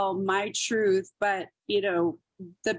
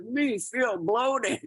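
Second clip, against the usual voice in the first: caveman-ish commercial voice; 3 semitones higher, much slower than usual. Retold or imitated. Mocking a commercial woman.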